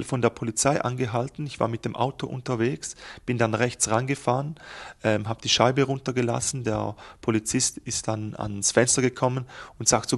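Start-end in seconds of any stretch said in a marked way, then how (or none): none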